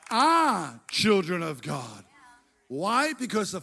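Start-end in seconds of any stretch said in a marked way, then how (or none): 0.1-2.1 are held low, slow and heavy; stress on ARE. Shown as a demonstration. Preacher insisting to the congregation we ARE children. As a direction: weigh down on are. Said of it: "the fact that we actually".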